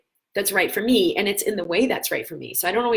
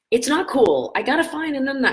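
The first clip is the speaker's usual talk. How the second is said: retold husband, louder and higher, mildly irritated; 8 semitones higher, 5 dB louder than usual. Husband hunting his battery, mock irritated and louder. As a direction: snap it back like a put-out husband. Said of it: "he was so irritated".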